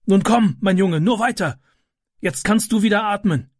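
encouraging rescuer. A narrator's reading. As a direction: coax the boy out of the smoke. Man encouraging Ram.

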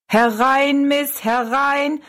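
high, drawn-out barker's call; showman calling out. A narrator's reading. Showman beckons Rosalie into the dwarf show, high drawn-out call. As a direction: call the crowd in, stretching the words. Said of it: "rief er Rosalie zu".

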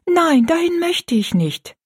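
put-on high, pleading girl's refusal; hasty protest. A narrator's reading. Rosalie begs not to go, high protest. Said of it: "sagte Rosalie rasch".

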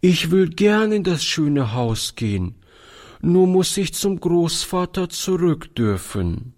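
put-on a small girl agreeing but clinging to grandpa; lightly voiced little girl; little girl Dolly. A narrator's reading.

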